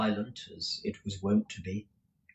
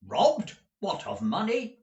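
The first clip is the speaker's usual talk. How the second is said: high-pitched, incredulous query; taken aback, demanding to know; startled question; 10 semitones higher than usual. Acted. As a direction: ask it in surprise, pitch jumping up. Ralph startled by the scream.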